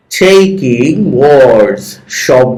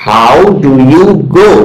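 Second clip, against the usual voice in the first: slow model reading; much slower than usual. Shown as a demonstration. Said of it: "with proper stress".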